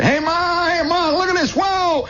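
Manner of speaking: put-on high-pitched kid hollering for Ma; shrill-high excited boy yell. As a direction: holler like a thrilled kid showing off. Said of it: "Now I'm a kid again".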